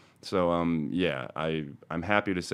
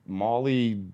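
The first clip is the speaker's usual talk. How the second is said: ordinary talk, nothing marked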